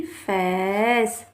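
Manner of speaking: फैस with the vowel drawn out very slowly; long vowel demo. Shown as a demonstration. Teacher showing children the long vowel, very drawn out. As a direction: stretch the ए in फैस long and slow. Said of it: "इसकी आपको ध्वनि थोड़ी लंबी निकालनी है".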